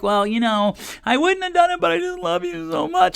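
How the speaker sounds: crocodile tears. Retold or imitated. Host mocking a parent's teary excuse, high and simpering. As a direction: whine it with fake tears in your voice. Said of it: "and the crocodile tears come".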